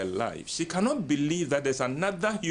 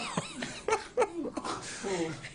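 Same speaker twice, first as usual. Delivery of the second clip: high laugh; 6 dB quieter than usual. Real, not acted.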